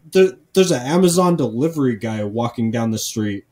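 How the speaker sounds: ordinary talk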